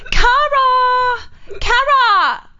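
very high, loud wailing call of a name; hammed-up high crying call; Phoebe. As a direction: wail the name like a drunk roommate in distress. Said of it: "She's like".

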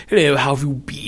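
put-on princely English voice; princely English accent. Shown as a demonstration. Co-host shows how princely types speak, princely English accent. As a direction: greet like English royalty, accent laid on. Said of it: "the princely English accent says".

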